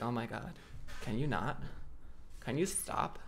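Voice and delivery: put-on soft whiny valley girl; lightly voiced valley girl complaint. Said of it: "Why your girl sound like a valley girl?".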